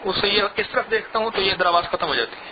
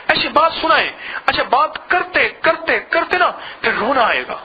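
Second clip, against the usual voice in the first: impassioned preaching; 6 semitones higher than usual. Acted. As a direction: build it up toward weeping. Building emotional exhortation. (جذباتی وعظ، بلند آواز میں)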